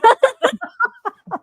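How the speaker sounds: high burst of laughter. Real, not acted. Cohost laughs at the mock sting. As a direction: crack up loudly.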